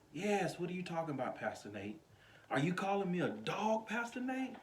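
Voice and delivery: mock questioning, pitch lifting on the name; questioning viewer. Voices a viewer questioning him.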